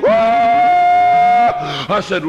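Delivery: high ringing "Woo" shout; imitating mama's joyful shout; holy shout. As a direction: let out a piercing praise cry. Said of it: "She said".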